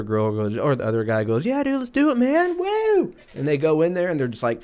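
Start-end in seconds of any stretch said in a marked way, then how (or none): 1.4-3.1 high-pitched, over-excited party whoop; mock an overexcited bro whooping his agreement; hyped-up dude. Acting out the hyped-up other guy.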